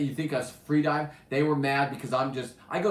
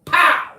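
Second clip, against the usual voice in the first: loud high gunshot sound effect; gunshot pow; 13 dB louder than usual. Retold or imitated. Telling about spearing the fish, shouted pow. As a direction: blast out the pow like a spear gun firing.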